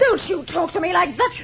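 high indignant retort; family row. Acted. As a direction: snap back, offended. Niece answers insult.

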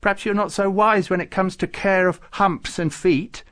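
high, loud, affronted dig; indignant. A narrator's reading. Farouk faulting Babucar's camel care, indignant. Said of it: "said Farouk indignantly".

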